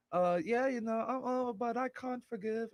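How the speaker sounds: high-pitched imitation of a British singer; British woman's accent. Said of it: "she's like".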